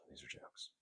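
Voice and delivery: whispered aside, no voice at all; mock whisper. Host whispering a reassurance to viewers. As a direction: breathe it like a secret to the audience.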